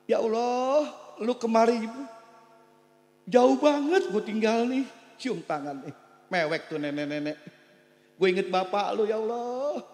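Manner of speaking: lightly voiced weeping old aunt; a hint of a tearful grandmother; old aunt crying. Elderly aunt greeting her nephew, mildly tearful. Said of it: "Nangis saudara bapak".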